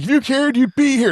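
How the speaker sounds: hammed-up teenage shout; mock angry yell. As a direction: yell it like a wounded teen. Imitating Peter yelling at Tony.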